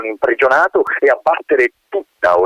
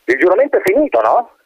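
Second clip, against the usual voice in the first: colonel checking; 9 semitones higher than usual. Acted.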